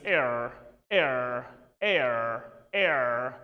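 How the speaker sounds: "air" with a prominent American r; American air, r clearly sounded; American pronunciation. Shown as a demonstration. Teacher shows how Americans say it, American r-coloured "air". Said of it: "because they do prominently read r".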